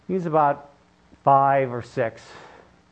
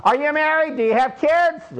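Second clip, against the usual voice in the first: put-on pestering boy; 10 semitones higher than usual. Boy keeps asking questions.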